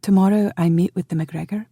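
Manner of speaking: plain reading voice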